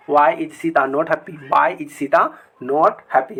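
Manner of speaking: why is Sita not happy said very slowly; slow dictation. Shown as a demonstration. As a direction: read it slowly for the class. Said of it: "Sita खुश क्यों नहीं है". (बहुत धीरे पढ़ना)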